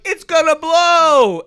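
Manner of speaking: loud, high, drawn-out yell; hammed-up disaster cry; panicked warning shout. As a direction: bellow it slowly as a movie warning. Acted warning before explosion.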